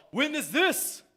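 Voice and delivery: big booming announcer title. Shown as a demonstration. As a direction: boom it out like a movie trailer. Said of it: "You gotta say it like that".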